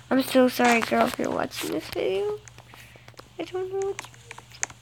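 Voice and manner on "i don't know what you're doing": higher, soft mock whine; half-joking higher confession. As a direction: play at being a bully. Mock self-insult to viewer.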